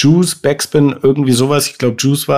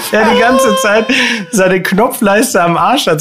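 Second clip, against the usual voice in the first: laughing; 8 semitones higher than usual. Real, not acted. Still laughing at own story.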